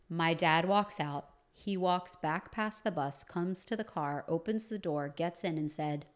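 normal talking voice

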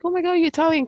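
excited greeting. Retold or imitated. Retelling meeting Italians in Boston, excited. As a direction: gush it, acting out the delight.

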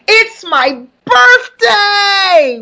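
high, slow, drawn-out celebratory cry; excited announcement. Real, not acted. Host tells viewers her birthday is coming, excited cry. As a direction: stretch it out into a gleeful squeal.

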